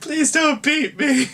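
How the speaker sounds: high, slow mock-crying plea; hammed-up whimper, begging not to be beaten; mock cowering plea. Mock terror of Irish man.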